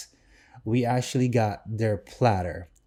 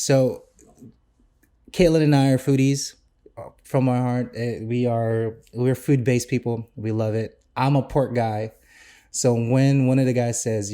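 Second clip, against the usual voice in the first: normal talking voice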